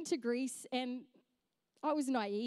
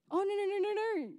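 flustered refusal; 7 semitones higher, 3 dB louder, much faster than usual. Retold or imitated. Refusing the offered pretzel.

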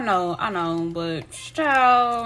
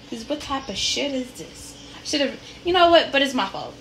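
ordinary talk, nothing marked

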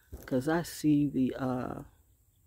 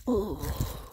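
ordinary delivery

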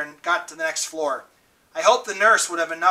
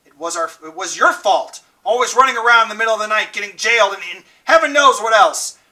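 fast, higher, louder scolding mother; angry accusation; 4 semitones higher, 8 dB louder than usual. Acted. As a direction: lash out with blame. Mother blaming the boys.

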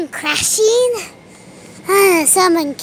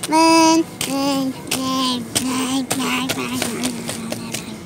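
playful fading echo; 5 semitones lower, 5 dB quieter, slower than usual. Pretend. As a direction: repeat it lower and softer each time. Repeats word, fading away like echo.

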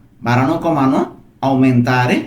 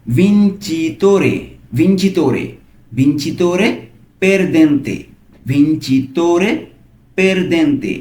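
slow model pronunciation; 2 semitones higher, much slower than usual. Shown as a demonstration. Teacher models Italian word slowly.

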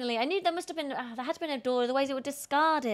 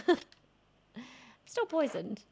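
laughing; much slower than usual. Real, not acted. Repeats line laughing.

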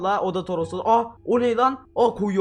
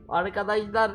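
normal talking voice

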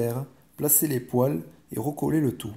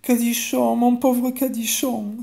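put-on loud, high-pitched wail of a child; slow, high, dismayed exclamation; anguished cry; 10 semitones higher, 6 dB louder, slower than usual. A narrator's reading. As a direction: cry out in distress for your friend. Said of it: "s'écria Pauline".

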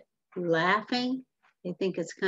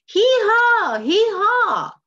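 high, loud, drawn-out donkey bray; really making noise; 14 semitones higher, 10 dB louder, slower than usual. Shown as a demonstration. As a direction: blare the hee-haw like a distressed donkey. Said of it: "now he's really making noise".

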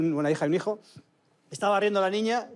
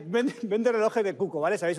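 normal talking voice

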